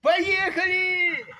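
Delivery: slow, high, loud drawn-out cheer; long high triumphant yell; excited cheer. Real, not acted. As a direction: shout it with joy as the train starts. Train departs, child cheers.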